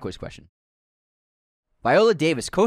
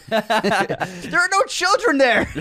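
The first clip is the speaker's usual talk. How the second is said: laughing; 7 semitones higher, much slower than usual. Real, not acted. Laughing through a joke.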